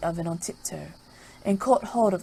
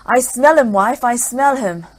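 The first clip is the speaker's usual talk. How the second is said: loud ogre insisting; 3 semitones higher, 10 dB louder than usual. Acted. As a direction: insist hungrily on the scent. Ogre insists to his wife.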